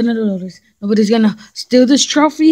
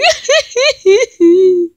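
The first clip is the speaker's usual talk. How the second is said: high cackle; 11 semitones higher, 4 dB louder than usual. Acted. High put-on laugh.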